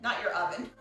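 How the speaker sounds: ordinary delivery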